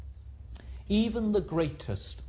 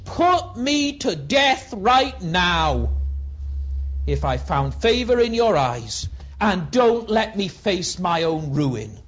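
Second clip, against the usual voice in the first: Moses pleading; 4 dB louder than usual. Acted. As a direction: beg God to end it. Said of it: "Moses says to the Lord".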